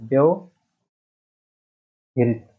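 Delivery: व्यवहृत stretched out slowly, piece by piece; drawn-out syllables. Shown as a demonstration. Teacher dictating the correct word, व्यवहृत very slowly. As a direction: spell out व्यवहृत by slow syllables. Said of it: "व्यवहृत शब्द लिखा गया है".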